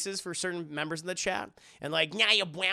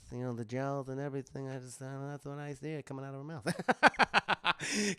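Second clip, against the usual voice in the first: Marlon Brando impression; 2 semitones lower than usual. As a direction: mumble it like the Godfather. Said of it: "I can do Brando".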